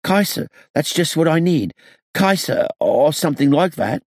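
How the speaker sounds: high, eager and lively; bright sudden enthusiasm; perking up. A narrator's reading. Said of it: "perking up".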